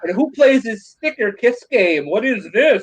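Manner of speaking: high-pitched incredulous mimicry; hammed-up bafflement, high voice; mock outrage. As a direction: imitate a scandalized friend questioning every item. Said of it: "You're like".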